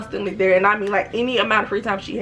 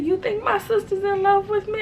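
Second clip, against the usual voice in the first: stunned high question; 12 semitones higher than usual. Retold or imitated. Boyfriend realizes about sister, high stunned question. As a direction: ask it dazed, up high. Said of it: "He said".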